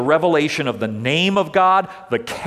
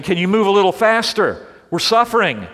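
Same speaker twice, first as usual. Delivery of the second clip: raised, pleading complaint, a touch louder; pleading Israelites; 6 semitones higher, 4 dB louder than usual. Retold or imitated. Preacher voicing suffering Israelites, impatient plea. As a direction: voice the weary people begging God to hurry. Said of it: "I can imagine the Israelites saying".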